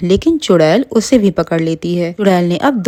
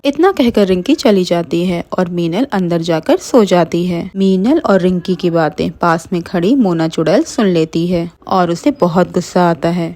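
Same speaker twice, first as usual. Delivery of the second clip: usual speaking voice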